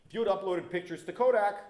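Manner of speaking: normal talking voice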